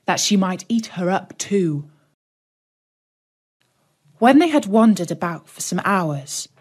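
usual speaking voice

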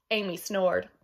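usual speaking voice

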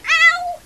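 loud, high "ow"; high yelp of pain. Acted. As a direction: yelp as if suddenly hurt. Cries out in play.

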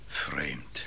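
muttered under breath; a hushed aside; whispered aside. Acted. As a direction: whisper it to yourself, puzzled. Lawyer muses on odd testimony.

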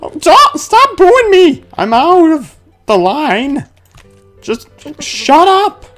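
high, whiny mock-upset yelling; hammed-up protest. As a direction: protest the booing in a high, wounded squeal. Protests being booed by crowd.

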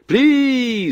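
high, drawn-out firing command; full-throated shout. A narrator's reading. Gunner gives the order to fire, yelled at full throat. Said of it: "заорал он во всю глотку".

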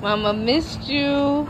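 high, slow coo to a dog; sweet high coo to a pet. Real, not acted. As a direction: coo it like you missed him terribly. Talking to her dog.

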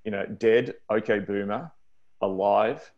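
normal talking voice

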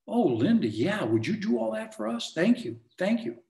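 high-pitched gushing thank-you; grateful seller. Acted.

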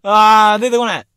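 loud, high wail of frustration; real frustration. Real, not acted. Radio host still stuck on a forgotten line, exasperated. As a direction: cry out at a word that won't come. Still cannot recall the word.

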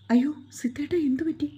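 high, panicked call to him; alarmed and scared for him; frightened girl calling out; frightened cry. A narrator's reading. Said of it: "മാളുവിന് പേടിയായി".

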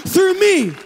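Isaiah offering himself to God, rising to a shout; passionate surrender. Acted. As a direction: pour it out, climbing to a desperate cry of yes. Isaiah answers God, preacher climaxing.

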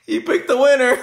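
high laughing cheer; laughing excitedly. Real, not acted. Laughing at the winning pick.

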